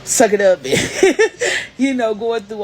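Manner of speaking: laughing through the words; laughing while telling it. Real, not acted. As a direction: chuckle at the memory. Laughing at her younger self.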